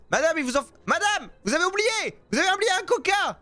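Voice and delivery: high urgent call after a customer; calling after someone. Real, not acted. Seller to customer leaving without the coke, calling out. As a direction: call out to stop them leaving.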